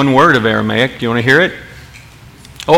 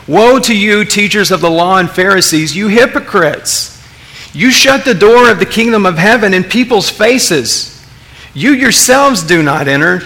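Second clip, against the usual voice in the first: higher-pitched, emphatic rebuke; raised voice; 6 semitones higher than usual. Acted. Jesus upset with Pharisees, voiced by preacher, raised and urgent. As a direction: raise the voice at them, upset and forceful. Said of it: "Jesus might even raise his voice a little bit".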